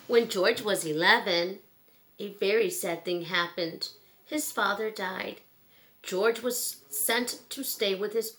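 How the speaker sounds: clear, gentle storybook read-aloud; reading to children. Acted. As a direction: read it like bedtime story time for kids. Reads picture book to children.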